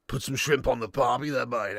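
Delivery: low, soft, gruff Australian voice; gruff Aussie. Acted. Joking about shrimp on the barbie, gruff Aussie. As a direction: put on a quiet, gruff Aussie growl. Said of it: "Gruff Aussie, they need water".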